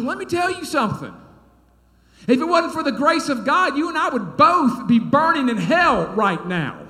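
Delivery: high, loud, indignant rebuke; aggravated. Retold or imitated. Pastor rebuking a council member for judging, aggravated. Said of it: "'cause I was aggravated".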